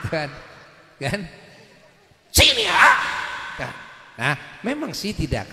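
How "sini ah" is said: high-pitched shouted summons; mock yell, pitched way up; too-loud voice. Shown as a demonstration. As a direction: imitate a wife calling far too loudly. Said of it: "tapi suaranya terlalu keras".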